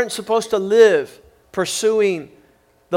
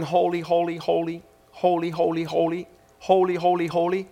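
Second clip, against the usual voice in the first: soft, low mechanical chanting of holy; broken autopilot drone; 3 semitones lower, 7 dB quieter than usual. Acted. Preacher mocks angels on broken automatic pilot, droning. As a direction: repeat it tonelessly, like a stuck machine. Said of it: "on broken automatic pilot going".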